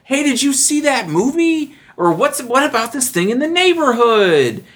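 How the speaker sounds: oversell the friendliness; schmoozy small talk. Retold or imitated. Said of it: "They're like".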